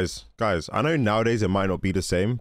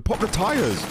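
urgent urging; 10 semitones higher, slower than usual. Real, not acted. Urging on the film hero.

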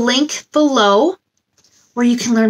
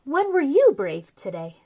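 soft, gentle read-aloud of a picture book; storybook reading; 3 semitones higher, 10 dB quieter than usual. Acted. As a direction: read it softly to little kids, like a bedtime book.

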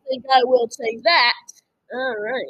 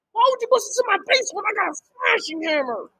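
ordinary delivery

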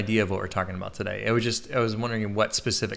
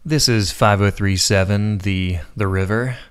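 sexiest DJ voice; 2 semitones lower, 7 dB louder, slower than usual. Pretend. Host playing radio DJ on request, sexy DJ voice. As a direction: croon it like a late-night jazz DJ, drawn out. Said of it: "give me your sexiest D J voice".

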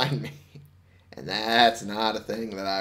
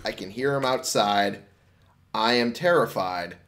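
usual speaking voice

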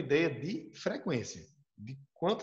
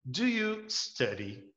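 "Do you study?" spoken very slowly with a rise; teacher spelling out "Do you study?" word by word; slow classroom demo of a question; slow English model; much slower than usual. Shown as a demonstration. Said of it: "uma frase muito besta aqui".

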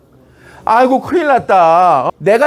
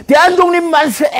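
high-pitched acted cheer; rallying cry; 9 semitones higher than usual. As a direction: cry it out like an independence fighter. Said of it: "이렇게 외치겠지".

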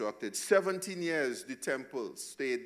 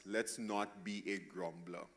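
soft, much quieter gentle warning; loving warning; 4 semitones lower, 8 dB quieter than usual. Real, not acted. Pastor gently warning the church against grumbling, softly. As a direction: lower your voice and warn them lovingly. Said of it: "lovingly warn us".

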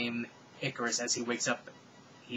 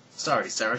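ordinary delivery, nothing marked

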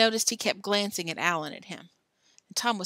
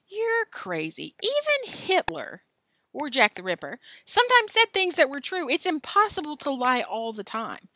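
heated arguing; 7 semitones higher, 4 dB louder than usual. A narrator's reading. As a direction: argue it hard. Billy arguing.

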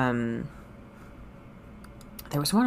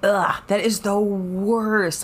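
slow, louder in disgust; slow disgusted groan; 5 semitones higher, 5 dB louder, much slower than usual. Real, not acted. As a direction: groan it out grossed out.

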